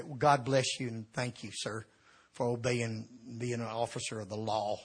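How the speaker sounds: sweet as pie while fuming inside; swallowing anger, over-polite and soft; forced calm. Retold or imitated. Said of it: "trying to be calm and say".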